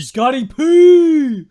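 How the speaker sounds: slow, loud hype call. Pretend. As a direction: call out the name, drawn out.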